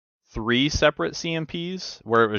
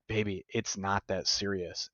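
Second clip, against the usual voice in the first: a soft, slow, calming wife's reply; soothing wife; 10 dB quieter, slower than usual. Retold or imitated. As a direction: gently talk him down the way his wife did. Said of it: "my wife would be like".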